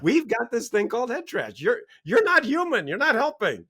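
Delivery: fired up. Real, not acted. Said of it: "I'm getting all really fired up there".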